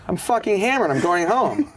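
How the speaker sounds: loud tipsy declaration; drunk exclamation. Retold or imitated. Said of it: "And I'm like".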